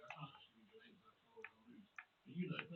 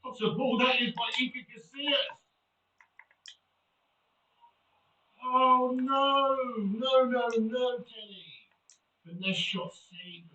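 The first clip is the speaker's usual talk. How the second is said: loud, high, drawn-out no no no; loud anguished reaction; 5 semitones higher, 18 dB louder, slower than usual. Real, not acted. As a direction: groan the near miss out loud. Near miss, loud reaction.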